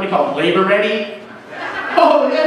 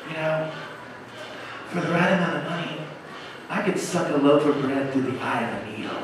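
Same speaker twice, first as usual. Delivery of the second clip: hushed and softer, leaning in close to his ear; close-up stripper murmur; 5 semitones lower, 9 dB quieter than usual. Retold or imitated. Stripper leans in close to a customer, softly. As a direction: pitch it low like a private offer.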